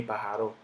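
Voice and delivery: pájaro stressed on RO, paja-RÓ; wrong final stress. Shown as a demonstration. Teacher rejecting a wrong version, pájaro stressed on final RO. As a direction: land on the end of pájaro. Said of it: "Yo no digo pájaro".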